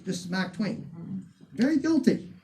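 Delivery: ordinary delivery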